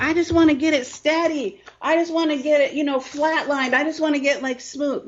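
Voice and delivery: high, pleading mock business owner; imitating a wishful business owner, high and pleading; whiny business owner. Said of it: "we're like".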